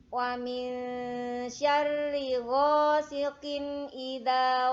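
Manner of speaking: slow, loud melodic chanting; Quran recitation. Shown as a demonstration. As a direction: chant it slowly like murottal. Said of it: "Kita ulang dari ayat pertama".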